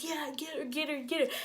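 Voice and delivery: high, hushed egging-on; hype man. Retold or imitated. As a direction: imitate a friend cheering on a fight, softly. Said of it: "she was always the hype man".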